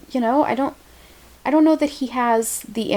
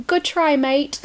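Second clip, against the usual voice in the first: put-on Australian accent; 6 semitones higher, slower than usual. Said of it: "they're gonna say".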